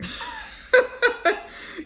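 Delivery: short burst of high laughter. Real, not acted. Reader laughing at her spirit guides, high. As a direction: laugh out at the card.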